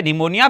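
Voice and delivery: निमोनिया with silent p; right pronunciation. Shown as a demonstration. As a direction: start निमोनिया on n, no p. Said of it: "क्या पढ़ेंगे". (निमोनिया, 'प' चुप रखकर)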